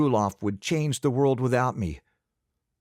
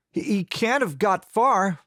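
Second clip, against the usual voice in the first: too quick, nervous; 9 semitones higher than usual. A narrator's reading. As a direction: blurt it out guiltily fast. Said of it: "I said too quickly".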